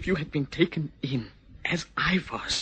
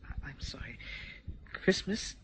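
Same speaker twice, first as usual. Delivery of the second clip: soft hesitant; 10 dB quieter than usual. Acted. Gentle refusal.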